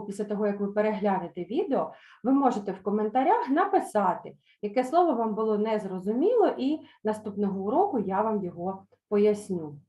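ordinary talk

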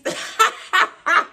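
real burst of airy laughter; breathy laugh. Real, not acted. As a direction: laugh it out, unvoiced and high. Laughing at own story.

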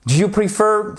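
prefer haut, accentué sur -FER; phrase modèle aiguë, prefer appuyé sur la fin; accent sur la seconde syllabe. Shown as a demonstration. (prefer, seconde syllabe marquée, voix haute)

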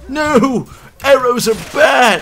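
high panicked yell; shouting. Real, not acted. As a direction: yell out in pain. Hit by arrows.

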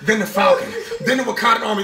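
ordinary delivery

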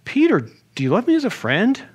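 high, swooping questioning voice; probing question. Acted. Jesus questioning Peter's love as a friend, high and probing. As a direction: ask it high, rising at the end.

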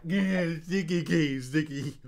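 loud, teasing chant of chat; mocking chat. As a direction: mimic trolls jeering loudly. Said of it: "They're all like".

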